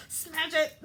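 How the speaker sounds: high, loud giggling through it; real laughter mid-skit; laughing. Real, not acted. Laughing during bit.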